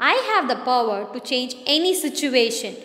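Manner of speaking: ordinary delivery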